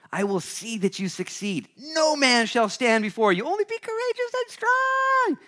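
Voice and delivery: hammed-up rallying call, climbing sharply in pitch; rousing promise. Preacher voices God's promise to Joshua, rousing and building. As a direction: build to a very high, loud cry.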